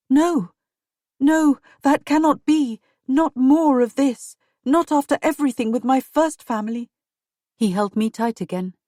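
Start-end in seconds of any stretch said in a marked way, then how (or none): 0.1-6.9 higher, louder pleading no; distressed protest. Acted. Wife begging her husband not to face ruin, higher and louder. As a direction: cry out against losing everything again.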